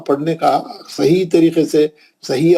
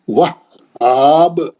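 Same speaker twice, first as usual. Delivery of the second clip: slow, drawn-out recitation with a bouncing qalqala at the end; tajweed recitation, strong qalqala on the final letter; Quran recitation; 3 dB louder, much slower than usual. Shown as a demonstration. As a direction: recite it slowly and let the last letter echo. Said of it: "दो बार पढ़ना है".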